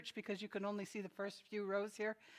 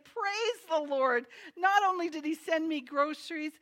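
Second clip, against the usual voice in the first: high, loud, excited acting; raised, celebrating voice; jubilant praise; 6 semitones higher, 5 dB louder than usual. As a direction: proclaim it like a delighted old believer. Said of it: "started jumping up and down, clapping her hands".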